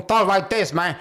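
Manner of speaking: a bad actor's fake Cuban accent; mock Spanish accent. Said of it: "cosplaying what he thinks a Spanish guy sounds like".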